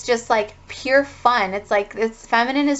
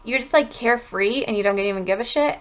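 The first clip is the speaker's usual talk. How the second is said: usual speaking voice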